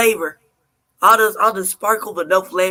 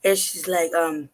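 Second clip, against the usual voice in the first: ordinary talk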